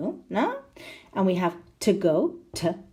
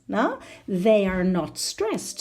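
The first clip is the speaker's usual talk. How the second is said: normal talking voice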